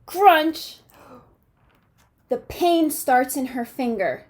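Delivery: expressive picture-book read-aloud; reading to children. A narrator's reading. Teacher reading a storybook to her class, lively and expressive.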